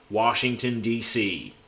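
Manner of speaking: Washington DC in an American accent. Shown as a demonstration. Streamer showing a US viewer his American accent. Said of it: "I can do quite a good American accent".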